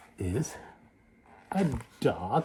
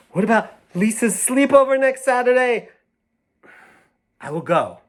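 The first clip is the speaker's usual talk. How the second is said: put-on high, louder eager girl; excited idea; 12 semitones higher, 5 dB louder than usual. The girl gets excited about Lisa's sleepover, high and loud. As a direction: burst out with the idea. Girl's new scheme.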